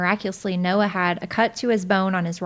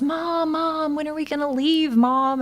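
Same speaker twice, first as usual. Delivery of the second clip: higher, lightly voiced whining little boy; whiny bored kid; 8 semitones higher than usual. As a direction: playfully mimic a bored five-year-old tugging at mom. Bored son tugging on clothes.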